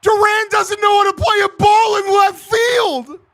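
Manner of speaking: loud and high, yelling at the screen; exasperated fan howling at the walk-off loss; frustrated fan shout. Real, not acted. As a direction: bellow it out as the game slips away. Fan yelling at his team losing.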